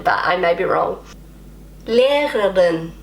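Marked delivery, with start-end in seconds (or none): 1.9-2.9 slow, laboured learner's "Lehrerin"; clumsy German pronunciation. Shown as a demonstration. Learner failing at a hard German word, clumsy attempt. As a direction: struggle slowly through the r sounds in "Lehrerin". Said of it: "That sounds terrible".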